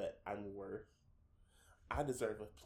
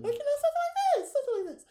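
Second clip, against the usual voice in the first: much higher, faster voice; high-pitched voice; 14 dB louder, much faster than usual. Shown as a demonstration. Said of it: "let's say my voice is a little higher pitch".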